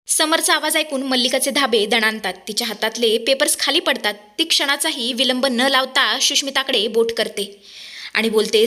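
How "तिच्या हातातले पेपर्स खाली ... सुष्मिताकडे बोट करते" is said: ordinary delivery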